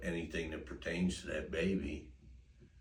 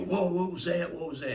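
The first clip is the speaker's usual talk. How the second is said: much higher, louder spooked cry; startled dog voice; 13 semitones higher, 9 dB louder than usual. Pretend. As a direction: voice the spooked dog, high and loud. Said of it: "Dog's gonna".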